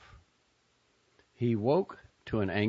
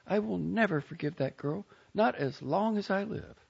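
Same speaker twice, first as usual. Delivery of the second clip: higher, tearful-tinged Southern aunt; upset Southern aunt; 12 semitones higher than usual. A narrator's reading. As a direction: voice the bitter aunt, pitched up. Aunt Maisie voiced by reader.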